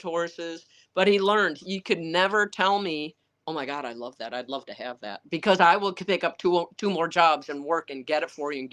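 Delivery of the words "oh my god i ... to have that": low, soft quoted wish; imitated wistful aside. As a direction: murmur it low, as if wishing aloud. Imitates his quiet wishing aloud.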